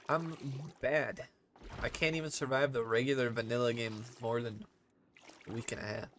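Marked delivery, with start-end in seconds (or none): none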